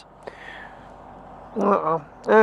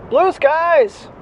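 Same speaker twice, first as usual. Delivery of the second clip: excited; 8 semitones higher than usual. Real, not acted. Delighted at the sky.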